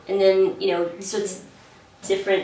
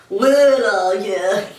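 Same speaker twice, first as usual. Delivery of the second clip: lightly done New Jersey accent; a hint of a Jersey puppet voice; slower than usual. Shown as a demonstration. Puppet agent demoing a Jersey accent for the host. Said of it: "kind of a New Yorker".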